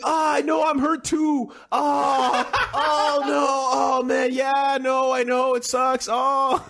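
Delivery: fake-console the crying brother while cracking up; laughing fake sympathy. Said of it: "He's like".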